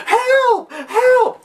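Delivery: hammed-up damsel calling for help; mock damsel cry. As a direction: play the helpless partner wailing. Helpless partner calling out.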